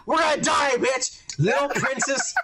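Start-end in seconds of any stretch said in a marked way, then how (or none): 0.1-1.2 high-pitched mock panic; Obi-Wan freaking out. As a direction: act out an angry, doomed outburst. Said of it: "he starts freaking out and getting mad".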